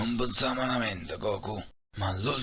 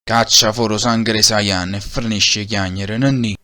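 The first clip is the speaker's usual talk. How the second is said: minaccioso; 7 dB louder than usual. Acted. Junior minaccia Goku.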